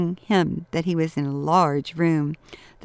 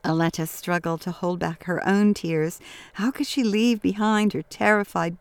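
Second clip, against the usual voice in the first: usual speaking voice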